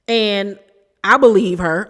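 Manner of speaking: ordinary delivery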